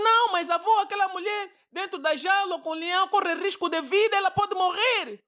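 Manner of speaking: put-on high, loud child insisting; anxious child. Grandson insisting to grandpa that the woman will die, high child voice.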